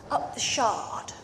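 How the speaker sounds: slow whisper, like sharing a secret. Retold or imitated. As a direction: breathe it out as a conspiratorial tip. Friend proposes the posh wine bar.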